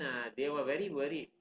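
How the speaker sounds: ordinary delivery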